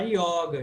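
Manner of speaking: yoga with an open O. Shown as a demonstration. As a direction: say 'YAW-ga' for yoga. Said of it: "registra com som aberto".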